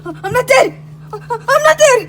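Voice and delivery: high, loud, jubilant cry; sudden revival shout. Acted. Mario reviving in the cold fridge, loud shout. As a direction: burst out loudly as if revived.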